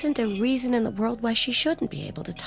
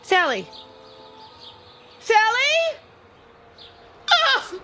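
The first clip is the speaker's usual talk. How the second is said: calls rising to a scream; frantic calling; 13 semitones higher, 4 dB louder, slower than usual. Acted. A mother calling for her drowned daughter, building to a scream. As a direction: call her name louder and louder until you break.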